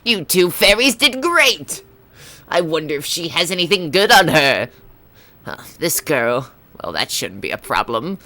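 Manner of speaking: put-on Skull Kid voice. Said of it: "this is my Skull Kid voice".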